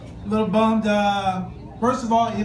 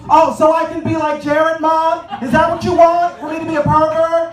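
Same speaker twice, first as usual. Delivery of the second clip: higher, louder mock-kid protest; indignant kid talking back; 6 semitones higher, 6 dB louder than usual. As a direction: argue back like an outraged child.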